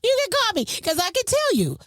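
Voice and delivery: high-pitched, just out of a laugh; real amusement lifting the voice high; laughter-lifted voice. Real, not acted. Repeats offer straight after laughing hard.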